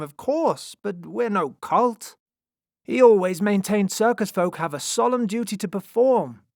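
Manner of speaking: high, loud impassioned protest; appalled lion tamer. A narrator's reading. Lion tamer defends Moreau's craft, high and impassioned. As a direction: defend him loudly, aghast at the charge. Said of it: "The lion tamer's eyes widened".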